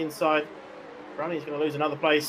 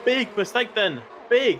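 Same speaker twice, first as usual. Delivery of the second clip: higher, louder excited call; excited exclamation; 7 semitones higher, 3 dB louder, slower than usual. Real, not acted. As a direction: punch out the shock. Commentator reacts to a big mistake.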